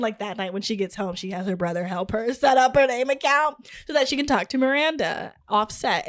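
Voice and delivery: normal talking voice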